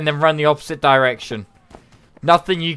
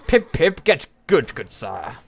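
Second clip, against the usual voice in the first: put-on British accent; slower than usual. Reading out what chat said, in a British accent. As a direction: quote it as a mock Brit, dropping off at the end. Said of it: "in the British accent".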